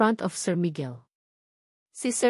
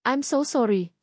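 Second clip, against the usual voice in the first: sugary fake sympathy, lightly voiced; mock-sorry with a sly sweetness; a hint of false pity; fake concern. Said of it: "dripping with fake concern".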